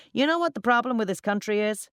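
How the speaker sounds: loud, high-pitched bar ranter; talking too loudly. A narrator's reading. Drunken account manager addressing colleagues, talking too loudly. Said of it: "talking too loudly".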